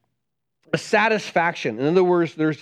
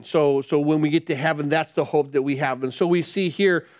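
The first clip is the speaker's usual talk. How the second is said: usual speaking voice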